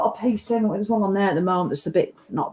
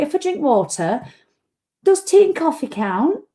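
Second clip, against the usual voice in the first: much higher, rising mock question; mock whiny client; 6 semitones higher than usual. Imitating Janice asking her trainer, high mocking whine. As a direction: squeak the question like a dodging client.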